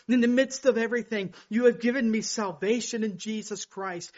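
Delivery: soaring sermon voice; impassioned preaching. Acted. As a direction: lift it up like a proclamation. Preaching God's words to him.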